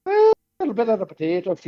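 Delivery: a quick Irish accent on the line. Shown as a demonstration. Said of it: "for the Irish".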